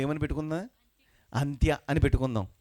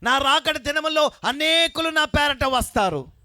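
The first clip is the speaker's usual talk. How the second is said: high, loud, slow scripture call; full-force preaching; 13 semitones higher, 6 dB louder, slower than usual. Acted. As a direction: call the verse out over the congregation. Preacher leads scripture reading. (పెద్ద గొంతుతో వాక్యం చదవడం)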